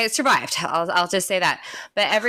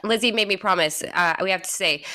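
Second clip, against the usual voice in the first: ordinary talk, nothing marked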